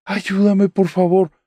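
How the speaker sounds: high, slow, strained plea for help; weak cry for help. Retold or imitated. Pinned down in a nightmare, calling her husband with a strained high plea. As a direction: call out for help without the strength to shout. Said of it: "comienzo a gritar y empiezo a decir".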